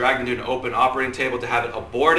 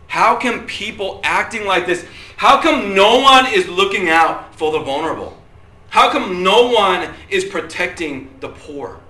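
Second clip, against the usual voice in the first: raised, insistent rhetorical questions; indignant pleading; 6 semitones higher, 5 dB louder than usual. Real, not acted. Preacher asking who protects the vulnerable, indignant. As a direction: press each question with rising indignation. Preacher voices outrage at injustice.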